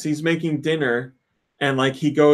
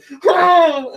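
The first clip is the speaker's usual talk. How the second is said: high loud shouted grunt; imitated angry yell; 5 dB louder than usual. Imitating the doctor slamming the controller, a high shout. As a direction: yell like you're throwing it down. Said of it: "he just takes it and goes".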